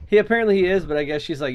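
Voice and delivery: ordinary delivery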